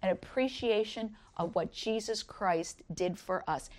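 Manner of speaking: ordinary delivery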